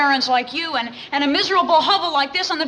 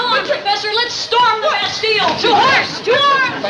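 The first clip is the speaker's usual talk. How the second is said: rising, loud call to arms; rallying battle cry; 6 semitones higher, 4 dB louder than usual. Acted. Rallying cry to storm Bastille.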